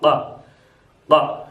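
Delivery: क said as a heavy q from the throat; deep q sound. Shown as a demonstration. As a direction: push क back to the throat. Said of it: "यहाँ से निकलता है क".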